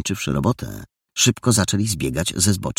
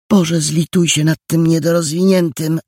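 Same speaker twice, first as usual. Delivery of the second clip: high, loud appeal to heaven; exasperated plea; 11 semitones higher, 6 dB louder than usual. A narrator's reading. Jola prays over the dim boy, loud exasperated plea. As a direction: wail it upward in despair at his stupidity. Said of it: "Jola uniosła ręce ku niebu".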